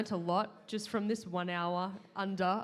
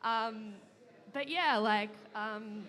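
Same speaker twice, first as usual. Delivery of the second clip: ordinary talk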